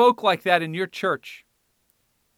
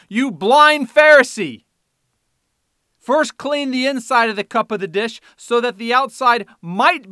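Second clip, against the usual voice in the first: loud rebuke; 6 semitones higher, 9 dB louder than usual. Acted. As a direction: denounce the blind Pharisee. Reading Jesus' rebuke.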